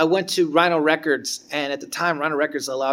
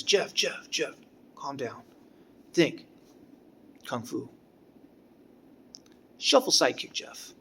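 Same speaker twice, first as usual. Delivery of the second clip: calming; 6 dB quieter than usual. Retold or imitated. Teacher calming frustrated student.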